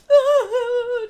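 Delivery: loud, very high fake sob; hammed-up wail of a weeping princess; mock crying wail. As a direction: imitate a loud, high cry. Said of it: "She runs out crying".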